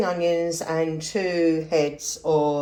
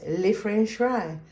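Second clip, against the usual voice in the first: mock French accent; 5 semitones higher, slower than usual. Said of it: "I can't do the accent as well as her".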